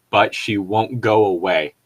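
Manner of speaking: normal talking voice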